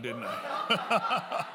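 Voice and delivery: laughing through the words; real laugh while asking. Real, not acted. Laughs at own joke.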